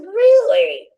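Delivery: sudden high, loud burst of surprise; can't believe what Joel just said; astonished really. Retold or imitated. To Joel, who wants spiritual films, loud high amazement. Surprised reply to Joel's wish.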